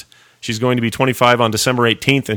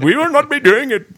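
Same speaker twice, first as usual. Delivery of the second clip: very high mock Indian accent. Host imagines telling the staring lady, high mock Indian accent. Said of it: "broke out in this Indian voice".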